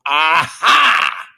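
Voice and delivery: 1 triumphant aha. Retold or imitated. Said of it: "I go".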